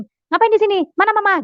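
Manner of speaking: high-pitched retold questioning; startled husband. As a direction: imitate a surprised father quizzing his child. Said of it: "Suami saya kaget". (menirukan ayah kaget bertanya, nada tinggi)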